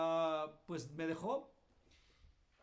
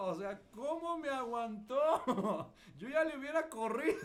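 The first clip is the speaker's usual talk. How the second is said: amused loud disbelief; laughing; 5 semitones higher, 7 dB louder than usual. Real, not acted. Laughing at own behaviour.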